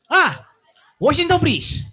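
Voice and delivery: put-on "Washington Bridge" said with a thick Spanish accent, loud and high; the dad sounding out "Washington Bridge" the Spanish way, lifted and loud; hammed-up Spanish-accented "Washington Bridge". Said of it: "With the accent".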